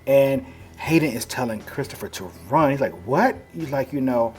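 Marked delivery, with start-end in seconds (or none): 3.1-3.4 startled what. Acted. Told to run from werewolf.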